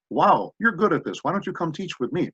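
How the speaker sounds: high, animated quote of an admirer; impressed praise. Retold or imitated. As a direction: voice an impressed teacher, pitch lifted. Acting out impressed teachers' praise.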